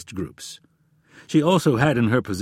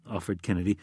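ordinary delivery, nothing marked

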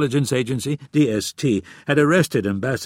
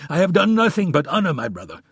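higher, louder indignant protest; indignant prince; 8 semitones higher, 4 dB louder than usual. A narrator's reading. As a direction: defend yourself, offended. Omar protests.

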